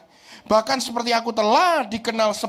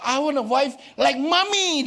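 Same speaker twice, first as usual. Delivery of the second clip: son's wish; 4 semitones higher, slower than usual. Retold or imitated. Son voiced.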